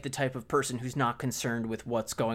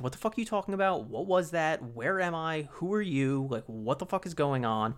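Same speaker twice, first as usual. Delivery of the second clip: fast, higher panicked questions; mock panic; 4 semitones higher than usual. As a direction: rattle them off like a freaked-out guy. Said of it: "he's like".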